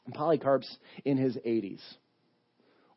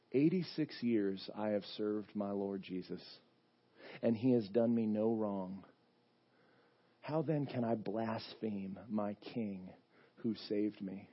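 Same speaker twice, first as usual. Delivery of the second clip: quiet steadfast confession; 5 semitones lower, 8 dB quieter than usual. Retold or imitated. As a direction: say it with calm devotion. Old martyr's quiet confession.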